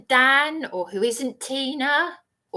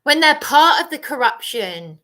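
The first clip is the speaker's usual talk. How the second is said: usual speaking voice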